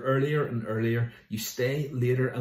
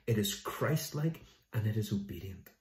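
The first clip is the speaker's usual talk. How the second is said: ordinary delivery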